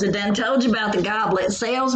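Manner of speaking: ordinary delivery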